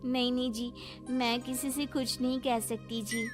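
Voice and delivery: low, soft and submissive; meek refusal. Acted. Daughter-in-law declining to speak to anyone, meekly. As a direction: decline softly, not daring to speak up.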